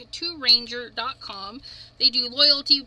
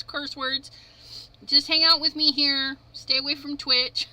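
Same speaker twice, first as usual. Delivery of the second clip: usual speaking voice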